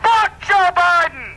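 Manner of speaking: high furious yell to the crowd; angry shout. Real, not acted. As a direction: bellow it out at the rally. Yells at rally crowd.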